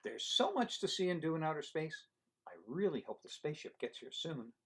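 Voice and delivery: lively storybook read-aloud; reading to children. Acted. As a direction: read it to a room of kids. Reading picture book aloud.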